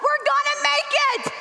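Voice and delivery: high, full-voiced yell; re-enacted triumphant battle cry; defiant shout. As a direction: shout it down at the top of your voice. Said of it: "immediately shout at the top of my voice".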